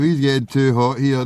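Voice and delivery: normal talking voice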